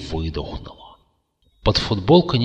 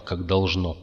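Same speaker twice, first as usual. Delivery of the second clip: ordinary delivery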